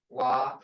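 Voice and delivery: "wa" low with a long ah; drawn-out "ah". Shown as a demonstration. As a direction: say "wa" low and let the vowel last. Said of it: "Ah, wa".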